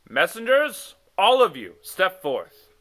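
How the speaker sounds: loud ringing summons; king calling out. Acted. The king summons every messenger, loud. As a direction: call the whole court forward.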